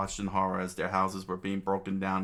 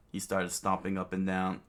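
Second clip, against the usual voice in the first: ordinary delivery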